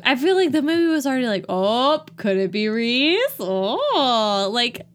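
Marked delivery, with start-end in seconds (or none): 1.5-4.5 louder, drawn-out hammed-up "ooh"; mock suspicion. Host parodies the movie's obvious hinting, mock suspicion. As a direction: tease it out with rising mock intrigue.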